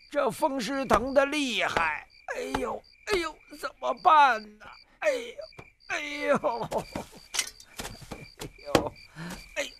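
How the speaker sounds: put-on groaning old man. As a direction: whimper through each ouch.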